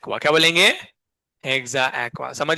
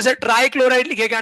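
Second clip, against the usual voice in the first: higher, louder mock student question; imitating students; 6 semitones higher, 6 dB louder than usual. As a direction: mimic an eager pupil asking sir. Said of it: "अब बच्चे बोलते". (बच्चों की नकल में ऊँची आवाज़ में सवाल)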